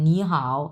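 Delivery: "নি হাও" with second tone then third; teacher's example "নি হাও" with converted first tone; tone change demo. Shown as a demonstration. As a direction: say "নি হাও", rising "নি" and low "হাও". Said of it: "এরকম".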